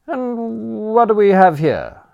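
put-on high, nervous young gent; flustered Bertram. Bertram to the butler after the row, high nervous question. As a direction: stammer out the question anxiously.

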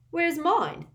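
put-on high, loud indignant boy; demanding. A narrator's reading. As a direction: demand it at once. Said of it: "demanded Ron at once".

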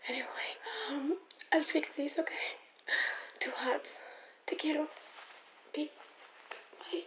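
hushed late-night voicemail whisper. Acted.

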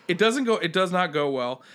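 ordinary delivery

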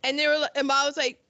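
ordinary delivery

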